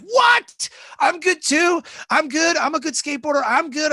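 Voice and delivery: put-on high, loud eager kids clamoring; kids' excited high shouts. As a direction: shout it like kids begging to be picked.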